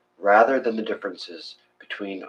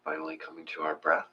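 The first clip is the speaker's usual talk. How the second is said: soft, hushed meditation voice with long pauses; guided meditation; 3 semitones lower, 10 dB quieter than usual. Acted. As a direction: ease them into the breath, quietly.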